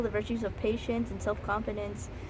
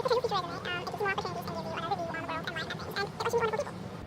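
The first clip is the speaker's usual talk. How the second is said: very high, fast chipmunk recital; sped-up voice; 12 semitones higher, much faster than usual. Acted. Vlogger rattles off textbook reasons, sped up and squeaky. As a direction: race through the list in a squeaky sped-up voice.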